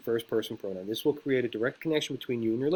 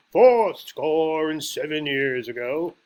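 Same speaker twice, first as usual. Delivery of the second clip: slow, raised, projected orator's voice; Lincoln oratory; 3 semitones higher, 8 dB louder, much slower than usual. Retold or imitated. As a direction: open a grand speech, loud and drawn out. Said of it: "you have, uh, A B Lincoln".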